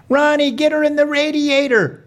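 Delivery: high, sustained holler from the stands; urging yell. Acted. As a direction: holler orders to the driver, pitched high. Family yelling from the stands.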